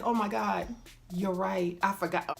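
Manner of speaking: quiet embarrassed confession; sheepish. Retold or imitated. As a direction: drop your voice, caught out. Embarrassed realization on the phone.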